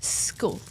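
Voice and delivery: "School" starting straight on a long hissed S; teacher's careful S opening "School"; careful initial S. Retold or imitated. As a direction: say "School" with no E before it. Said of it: "the S at the beginnings".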